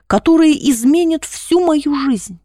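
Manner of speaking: plain reading voice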